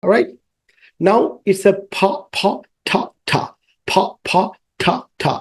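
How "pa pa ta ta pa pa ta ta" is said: pa, pa, ta, ta as short, soft, isolated sounds; phonics sounds. Shown as a demonstration. Teacher to young pupils, clipped soft phonics sounds. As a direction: sound out pa and ta slowly. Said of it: "sound হচ্ছে পি এবং টি এর sound".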